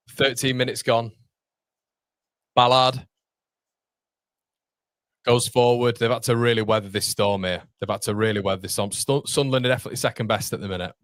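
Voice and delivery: quick, lively match call; steady commentary over a tense spell; play-by-play. Acted. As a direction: call the game as it unfolds.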